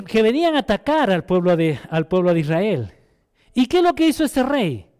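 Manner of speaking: usual speaking voice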